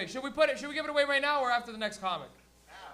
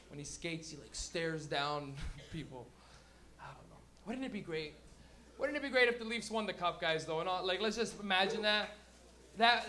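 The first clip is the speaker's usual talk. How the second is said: ordinary talk, nothing marked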